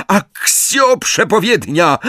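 high, slow incantation shout; high-pitched summoning call; shouted spell. A narrator's reading. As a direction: cry out the spell desperately. Villain desperately casts summoning spell.

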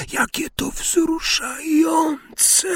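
high, slow sneering wail; mocking hiss. A narrator's reading. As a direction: sneer at the boy's courage. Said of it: "syknęła". (wysoki, szyderczy syk)

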